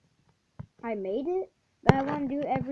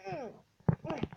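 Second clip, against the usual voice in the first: ordinary talk, nothing marked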